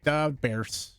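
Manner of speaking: mock Chicago superfan voice; Chicago accent. Shown as a demonstration. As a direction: put on a blunt Chicago accent. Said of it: "just the Chicago accent that you did".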